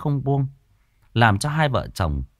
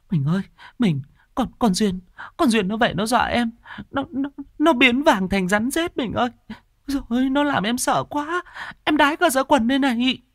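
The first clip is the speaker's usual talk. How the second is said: much higher, sobbing terrified wife voice; crying woman; 13 semitones higher than usual. A narrator's reading. Wife clings to husband, sobbing in terror. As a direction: wail it through tears like a frightened wife. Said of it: "Tuyết sụt sùi".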